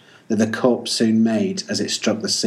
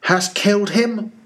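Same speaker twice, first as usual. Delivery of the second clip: sharp, much higher demand; eager demand; 10 semitones higher than usual. Acted. Ahab presses the captain about the whale, high urgent demand. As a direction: fire the question across the water.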